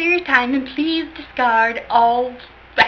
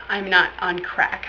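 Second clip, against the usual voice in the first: ordinary delivery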